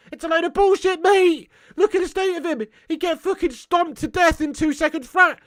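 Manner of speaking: loud, high-pitched outrage; incredulous rant. Real, not acted. Ranting about absurd casting of hooligan.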